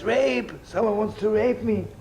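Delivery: high, loud, insistent complaint; old woman telling the officers what happened, high and loud; old woman crying out. Retold or imitated. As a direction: play it as a frantic grandmother telling the police. Old woman tells police, joke.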